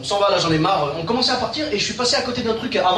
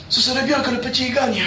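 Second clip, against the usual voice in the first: mock Italian mobster accent, slightly higher; 4 semitones higher than usual. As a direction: put on an Italian accent for a veiled threat. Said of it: "de prendre l'accent italien". (accent italien exagéré)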